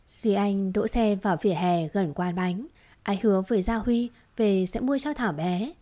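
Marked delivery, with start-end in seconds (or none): none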